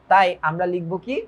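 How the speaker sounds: ordinary talk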